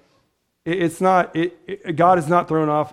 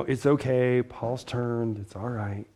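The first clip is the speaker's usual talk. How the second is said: much softer, calm reassurance; reassuring Barnabas; 5 semitones lower, 9 dB quieter, slower than usual. Retold or imitated. Barnabas calming the nervous disciples, softly reassuring. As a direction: soothe them down quietly. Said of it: "he and Silas are just, you know".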